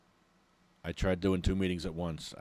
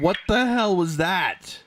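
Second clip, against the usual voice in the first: high, loud, slow incredulous cry; frustrated groaning; 11 semitones higher, 9 dB louder, slower than usual. Real, not acted. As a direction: drag it out in disbelief. Frustrated after muted meeting.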